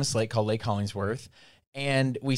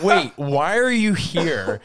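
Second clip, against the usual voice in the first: confused surprise; 5 semitones higher, 6 dB louder, much slower than usual. Retold or imitated. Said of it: "looked at them like".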